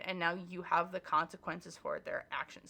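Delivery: ordinary delivery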